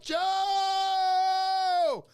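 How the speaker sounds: high, drawn-out whooping shout; hollered greeting. Real, not acted. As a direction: holler the name like a hype man, stretching it out.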